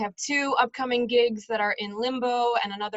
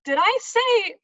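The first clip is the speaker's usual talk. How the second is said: high, loud, incredulous self-quote; flustered parent; 8 semitones higher, 7 dB louder, slower than usual. Acted. Parent repeating own slip about college, flustered. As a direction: catch yourself, aghast at the slip.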